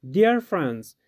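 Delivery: usual speaking voice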